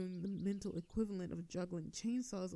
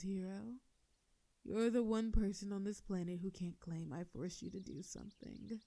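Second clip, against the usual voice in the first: ordinary delivery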